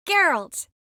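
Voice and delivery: high, loud cry of his name; urgent cry. Acted. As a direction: shout his name to stop him. Crying out to Geralt.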